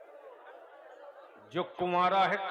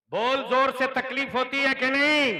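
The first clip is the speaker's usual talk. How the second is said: louder and higher, demanding an answer; 6 semitones higher, 12 dB louder than usual. Real, not acted. Cleric rousing the crowd to answer, shouting. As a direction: push it out, rousing the whole hall. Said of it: "अरे जोर से बोल".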